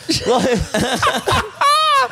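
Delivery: high, breaking burst of laughter; squealing laugh. Real, not acted. Cracking up retelling the thief's excuse, squealing laugh. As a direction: let a squeaky laugh escape.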